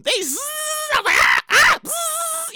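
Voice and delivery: buzzing drill noise with little "ah" cries; imitated dental drill. Host acting out getting fillings drilled, imitated buzz. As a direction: mimic the drill's zzz, open-mouthed ahs between.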